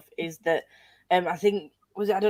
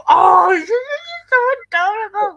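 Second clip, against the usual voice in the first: high, loud squealing gush; 13 semitones higher, 9 dB louder than usual. Real, not acted. Book fan gushing over a favourite character, squealing. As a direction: squeal over how adorable she is.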